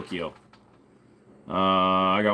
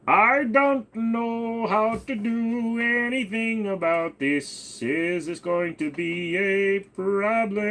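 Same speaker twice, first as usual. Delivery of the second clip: much higher, slow whimpering voice; whiny character voice; 10 semitones higher, much slower than usual. Pretend. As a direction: whine it like a helpless little figure. Plaintive high put-on voice.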